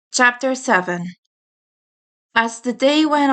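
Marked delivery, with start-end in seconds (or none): none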